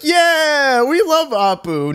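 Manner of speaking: high, eager imitation of a cheering audience; mock crowd cheer. Retelling the panel audience's answer, mock cheer. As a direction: cheer along like a whole crowd, mockingly. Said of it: "everybody was like".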